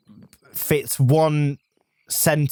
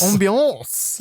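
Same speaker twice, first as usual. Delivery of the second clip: higher, French-accented "ambiance"; 5 semitones higher than usual. Shown as a demonstration. Repeating the fancy word to a friend, mock French accent. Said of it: "that French accent onto it".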